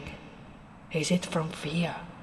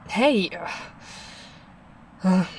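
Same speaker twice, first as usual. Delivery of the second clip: ordinary delivery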